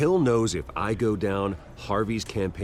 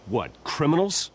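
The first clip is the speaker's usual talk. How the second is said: indignant disbelief; 7 semitones higher than usual. Acted. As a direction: voice jumps up, stung and incredulous. Bruce outraged at press accusation.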